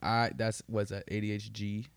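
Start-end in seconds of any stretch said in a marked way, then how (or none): none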